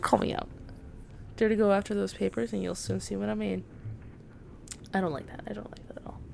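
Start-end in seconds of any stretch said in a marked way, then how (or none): 4.9-6.2 unvoiced worried whisper; uneasy whisper. Real, not acted. Gamer whispers unease about the witch's trick. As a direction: murmur your unease.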